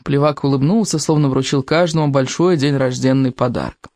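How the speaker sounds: ordinary delivery, nothing marked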